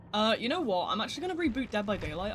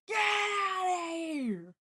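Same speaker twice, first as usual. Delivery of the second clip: very slow, stretched high yell; drawn-out shout; 10 semitones higher, 4 dB louder, much slower than usual. Pretend. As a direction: drag the shout out long. Yelling at the lagging stream.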